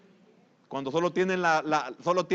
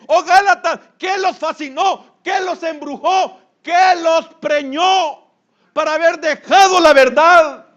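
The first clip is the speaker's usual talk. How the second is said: shouted, high rebuke, the questions drawn out slowly; Paul's rebuke; 12 semitones higher, 13 dB louder, slower than usual. Retold or imitated. Preacher voicing Paul shouting his rebuke at the Galatians. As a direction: thunder at the Galatians in disbelief. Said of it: "El apóstol Pablo le pregunta a los de Galacia".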